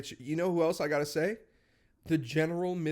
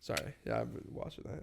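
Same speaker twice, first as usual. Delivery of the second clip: whispering; 8 dB quieter than usual. Real, not acted. Whispered aside.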